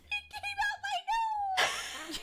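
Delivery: high, slow shriek through laughter; laughing yell. Real, not acted. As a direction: cry out mid-laugh. Laughing shout.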